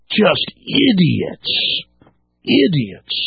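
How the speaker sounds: slow, stretched-out scorn; exasperated. Real, not acted. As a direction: draw the word out in disgust. Exasperated verdict after mimicking the cop.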